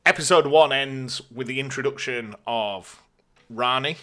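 ordinary delivery

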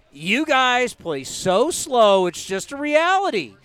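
slow, drawn-out dig; deliberately slow and scornful. Retold or imitated. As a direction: stretch every word to mock them. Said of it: "And I'm like".

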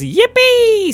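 very high, loud yippee; gleeful cheer. Acted. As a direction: squeal with little-boy joy. Samuel cheers.

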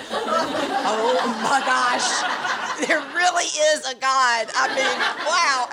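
high, laughing, drawn-out amazement; laughing in awe. Retold or imitated. Mother in the car hearing God's answer, laughing high with awe. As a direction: laugh through it like you just heard from God. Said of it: "And I laughed".

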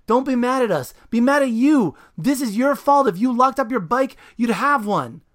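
high, loud furious yell; angry yell. Acted. Dan yelling up at the super, furious. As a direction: shout back up in anger. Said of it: "Dan yells up at Junior".

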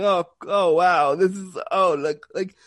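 loud, stunned exclamations at the news; amazed gasps. Retold or imitated. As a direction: react like you can't believe what you're seeing. Watching the US news from Canada.

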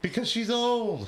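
high, drawn-out protest; exasperated comeback. Real, not acted. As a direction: stretch it out high with a laugh. Joking comeback amid laughter.

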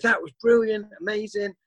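high-pitched, hammed-up American accent; over-the-top American. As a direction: imitate a gushing American, pitched well up. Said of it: "really over the top American".

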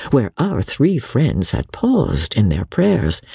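ordinary delivery, nothing marked

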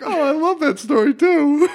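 high squealy mock little-girl voice; gushing little girl. Mocking young Instagram fans gushing over the story, high squeal. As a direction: gush like a twelve-year-old fan. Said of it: "these little kids are like".

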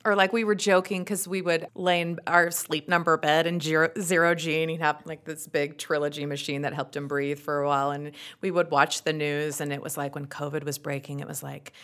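usual speaking voice

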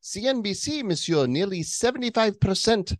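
put-on very high French-accented news read. Host reads a CNBC story, in a high French accent. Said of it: "I don't know what I'm doing now".

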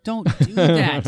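slow, loud telling-off; a drawn-out loud reprimand; stern scolding. Retold or imitated. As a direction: scold them like a naughty kid. Scolding filmmakers in acted quote.

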